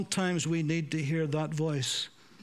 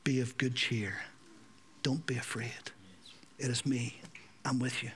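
soft comfort; 5 semitones lower, 5 dB quieter than usual. Acted. Jesus speaking comfort into fears.

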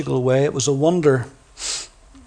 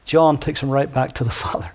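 ordinary delivery

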